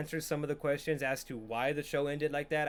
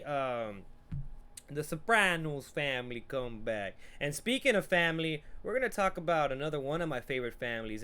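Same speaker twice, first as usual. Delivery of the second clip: usual speaking voice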